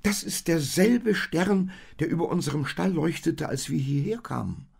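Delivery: high, loud excited donkey; amazed. A narrator's reading. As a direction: exclaim in excited recognition. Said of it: "staunte der Esel".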